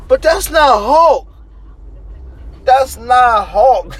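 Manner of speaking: high, loud insistence; real frustrated outburst; emphatic shout. Real, not acted. Ranting about tame Hulk.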